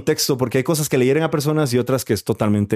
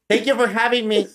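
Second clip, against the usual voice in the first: mock English; 9 semitones higher than usual. Switches to English joke.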